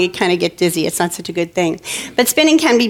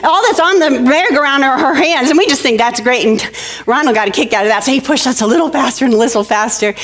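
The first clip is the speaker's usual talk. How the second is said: normal talking voice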